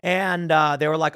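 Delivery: ordinary talk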